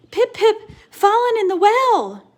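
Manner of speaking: high, loud breathless blurt; desperate child's cry; high panicked cry. Acted. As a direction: gasp out the last bit. Chang gasping to mother.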